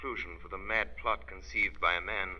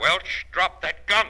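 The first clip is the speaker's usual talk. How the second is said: firm command; 7 semitones higher, 9 dB louder, slower than usual. Acted. Detective orders armed man.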